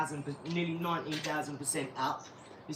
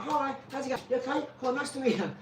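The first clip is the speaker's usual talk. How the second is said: higher, chirpy mock-polite greeting; overly nice greeting; 7 semitones higher than usual. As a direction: play the too-friendly Brit in New York, pitched up. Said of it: "'cause I'm like".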